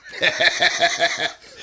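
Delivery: high breathy cackle imitating Kevin; a short high airy cackle, like Kevin; Kevin McCreary laugh. Repeating Kevin's laugh for the host, high breathy cackle. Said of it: "That's Kevin".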